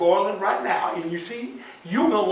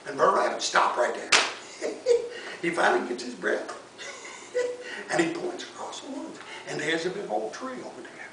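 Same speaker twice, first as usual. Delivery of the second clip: plain reading voice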